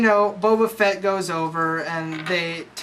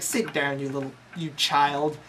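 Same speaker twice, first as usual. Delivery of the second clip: hushed menacing Vader; 4 semitones lower, 6 dB quieter than usual. Retold or imitated. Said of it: "and he's like".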